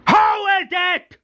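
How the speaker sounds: very high-pitched acted shout; furious shout. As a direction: explode into a screamed demand. Governor demands the culprit, now shouting.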